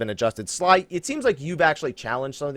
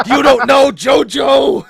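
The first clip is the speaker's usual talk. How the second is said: slow, drawn-out, much louder and higher put-on yell; mock meme shout; 11 semitones higher, 11 dB louder, much slower than usual. Teasing his co-host Sly with a meme catchphrase, slow hammed-up shout. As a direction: bellow the catchphrase slowly like a hammy anime villain.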